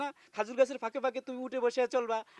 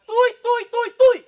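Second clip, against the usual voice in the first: tui shouted much higher and louder; husband screaming tui at his wife; furious shout; 10 semitones higher, 15 dB louder, slower than usual. Acted. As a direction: hurl each tui at her, screaming. Quarrel about disrespectful তুই.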